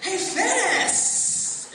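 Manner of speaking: loud, high, slow jeering call; mocking bully. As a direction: jeer down the hallway at the fat kid. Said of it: "somebody would be like".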